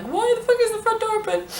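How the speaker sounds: high-pitched re-enacted panic; freaking out. Flatmates finding front door open, high panicked squeal. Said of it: "freaking out on the spot together".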